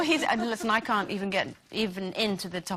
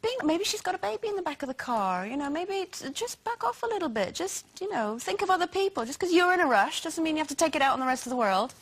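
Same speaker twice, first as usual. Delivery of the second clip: higher, pleading telling-off; retold scolding; 6 semitones higher than usual. Wife retelling how she scolds her husband, higher and pleading. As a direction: coax him to back off, higher. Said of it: "and I'll say to him".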